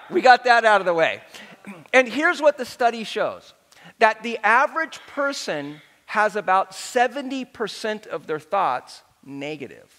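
normal talking voice

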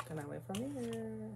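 playful teasing. Pretend. Kidding viewers that the story ends there, playful teasing. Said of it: "I'm playing".

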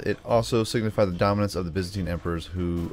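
normal talking voice

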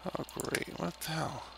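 hushed whisper, barely voiced. Real, not acted. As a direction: whisper so nobody wakes. Playing at 5am, not waking anyone.

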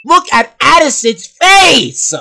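high, loud, drawn-out exclamation; priceless outburst. Real, not acted. Commentator pointing out a character's face, loud outburst. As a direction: cry it out slowly, stretching the last word. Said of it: "that line delivery was priceless".

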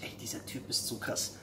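a breathless aside, all air and no voice; whispered thought. Retold or imitated. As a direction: whisper it to yourself in disbelief. The woman's inner thought. (geflüsterter Gedanke)

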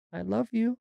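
high little-girl imitation; child's bunny voice. Daughter speaking as the bunny to her sister, high imitated voice. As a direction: say it high, as a kid voicing a stuffed animal.

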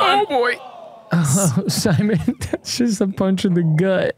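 high-pitched cry breaking into laughter; high, incredulous groan-laugh; pained laughing dismay. Real, not acted. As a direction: react to a gut-wrenching miss, laughing. Reacting to a painful miss.

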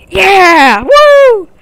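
loud, high triumphant whoop; real winner's shout; victory cheer. Real, not acted. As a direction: celebrate the win. Winning the match.

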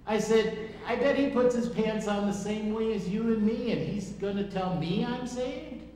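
high, loud mock disbelief; sarcastic retort. Preacher retells scoffing at evangelist, high and loud sarcasm. Mocking sarcastic reply retold.

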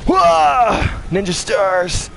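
high, excited cry; excited yell. Real, not acted. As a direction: yell it out with glee. Excited game reaction.

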